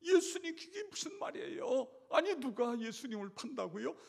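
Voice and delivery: high, loud, crying-out protest; shocked disbelief. Acted. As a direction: gasp it out in alarm. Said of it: "열두 제자가 눈이 휘둥글레져가지고".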